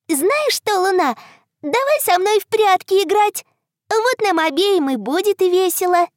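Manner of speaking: high and bright, eager; excited little girl. Acted. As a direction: coax a friend to play. Girl invites moon to play.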